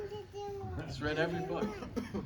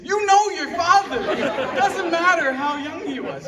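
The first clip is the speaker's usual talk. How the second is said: high, loud imitated outburst; exasperated exclamation; 12 semitones higher, 9 dB louder than usual. Uncle explaining dad's childhood stubbornness, exasperated outburst. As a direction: throw it out loudly as an exasperated relative.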